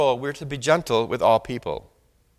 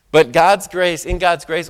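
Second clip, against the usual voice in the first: ordinary talk, nothing marked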